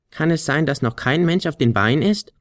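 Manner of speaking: hohe Flötenstimme; Flöte. A narrator's reading. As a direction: kleine Flöte fragt neugierig. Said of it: "begann die Flöte". (hohe, neugierige Flötenstimme)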